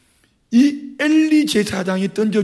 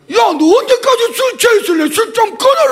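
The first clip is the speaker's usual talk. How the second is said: much higher, loud rebuking yell; scolding shout; 6 dB louder than usual. Retold or imitated. The priest Eli loudly scolds Hannah for drunkenness. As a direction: bellow at her like an angry priest. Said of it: "엘리 목사님은 좀 뻔뻔스러워".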